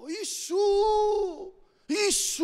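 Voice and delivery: high, long-held shouted call; crying out. Retold or imitated. Preacher re-enacting his cry to Jesus in the car, shouted. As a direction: shout the name upward and hold it. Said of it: "Και άρχισα να κράζω".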